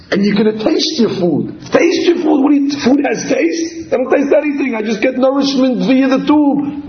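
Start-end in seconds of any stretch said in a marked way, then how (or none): 1.7-2.4 taste your food? asked much higher in shock; the fetus can't believe food has taste; incredulous. Acted. Fetus answers adult in disbelief. 2.4-6.9 higher, baffled protest; a fetus who has never tasted anything; raised voice of confused disbelief; incredulous. Acted. Fetus answers adult in disbelief.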